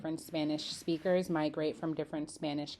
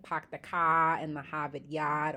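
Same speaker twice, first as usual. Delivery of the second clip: casual non-rhotic Boston slogan; Boston "pahk the cah"; Boston accent. Shown as a demonstration. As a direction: quote it Boston style, R's dropped. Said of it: "whenever you do hear".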